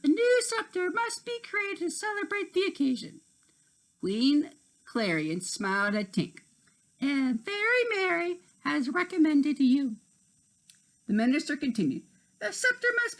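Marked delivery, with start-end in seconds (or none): 0.0-3.2 high put-on minister voice; minister character. A narrator's reading. The minister announcing the scepter task, high put-on voice. 7.0-10.0 a hint of a gentle queen; lightly voiced queen. A narrator's reading. Queen Clarion speaking.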